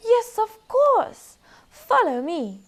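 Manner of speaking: very high, loud and slow; slow high badger. Badger agreeing to show the way, high and slow. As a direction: drawl it out grandly. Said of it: "replied the badger".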